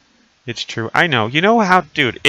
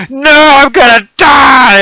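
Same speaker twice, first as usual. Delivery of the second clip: high, very loud wail; hammed-up terrified yelling; mock panicked scream; 14 dB louder, slower than usual. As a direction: scream back as if about to crash. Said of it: "And I'm like".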